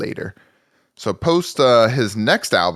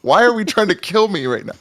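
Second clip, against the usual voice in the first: exasperated outcry; 10 semitones higher, 5 dB louder than usual. Retold or imitated. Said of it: "I'm like".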